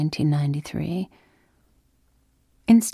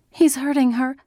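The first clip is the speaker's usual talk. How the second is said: alarmed; 8 semitones higher than usual. A narrator's reading. Child watching neighbour being beaten.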